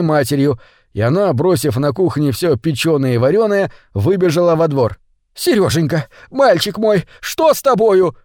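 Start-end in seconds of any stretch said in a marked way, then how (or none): 5.3-8.2 higher, alarmed mother's cry. A narrator's reading. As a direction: call to your boy in mild alarm. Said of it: "крикнула она". (встревоженный возглас матери, повыше)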